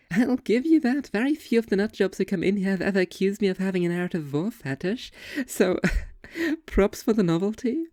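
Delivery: high, amused waitress teasing a customer; giggly diner waitress, high-pitched. Acted. Waitress figure voiced in story.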